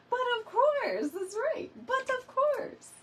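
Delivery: very high-pitched put-on friend voice; imitating a friend. Host reading a friend's text like she would say it, high playful imitation. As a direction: mimic how your friend would say it, way up high. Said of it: "like she would say it".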